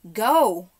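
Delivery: loud urgent yell; shout. A narrator's reading. Diggory shouts to Polly, loud urgent shout. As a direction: bark the command with all your breath. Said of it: "shouting to Polly".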